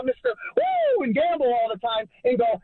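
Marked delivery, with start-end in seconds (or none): 0.0-1.0 high, drawn-out mock cheer; mocking rah-rah holler; mock hype whoop. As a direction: whoop it like an over-hyped coach. Caller mocking coach's hype persona.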